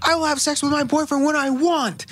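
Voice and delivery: high, indignant outburst at mom; high imitated defiance; mock defiant rant. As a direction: snap back like a teen. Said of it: "and she goes".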